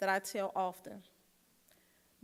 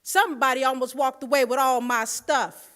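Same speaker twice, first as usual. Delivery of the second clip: sudden loud outburst; 4 semitones higher, 14 dB louder than usual. Retold or imitated. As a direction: blurt it out loud and high. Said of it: "she was sitting behind me and she said".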